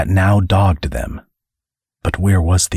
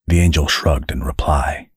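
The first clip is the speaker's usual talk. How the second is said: plain reading voice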